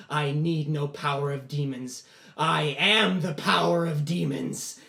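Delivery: laugh, then slow, loud boast; gloating boy. Acted. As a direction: chuckle and build to a drawn-out declaration. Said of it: "the boy laughs and he goes".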